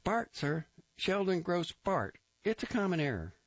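high, eager recruit reporting; Grossbart voice. A narrator's reading. Recruit reporting to the captain, high eager voice. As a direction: snap it out high and keen.

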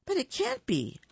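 high-pitched stunned protest; disbelieving Bear. Acted. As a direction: plead it in disbelief. Bear's disbelief.

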